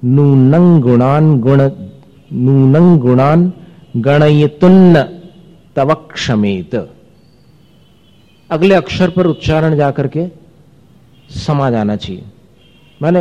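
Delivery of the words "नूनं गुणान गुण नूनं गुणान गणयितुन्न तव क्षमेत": slow recitation, नूनं said with a clear ng before गुणान; verse chanted slowly, stressing the anusvara sounds; careful anusvara. Shown as a demonstration. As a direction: dwell on the nasal in नूनं so it joins the next letter. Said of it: "अनुस्वार के उच्चारण पर ध्यान देना".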